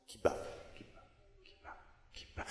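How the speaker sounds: breathy whisper, barely voiced; murmured pulse; whispered refrain. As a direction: let the heartbeat fade into a whisper.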